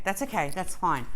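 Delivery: ordinary delivery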